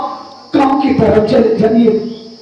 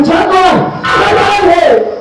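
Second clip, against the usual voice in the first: higher, slowing to a drawn-out shout; angry denunciation; 3 semitones higher, slower than usual. Acted. Brother-in-law denouncing the intruder to bhabhi, shouting. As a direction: cry out जानवर with loathing.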